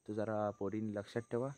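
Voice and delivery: hushed, people sleeping nearby; speaking softly. Real, not acted. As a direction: keep the voice down. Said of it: "हळू बोलतो".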